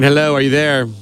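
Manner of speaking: usual speaking voice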